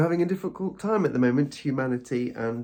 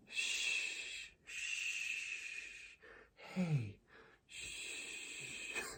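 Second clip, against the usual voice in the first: put-on whispered shushes like soothing a baby; gentle shushing; 19 dB quieter, much slower than usual. Shown as a demonstration. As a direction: shush yourself like a tiny baby. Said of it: "Just gently shush yourself".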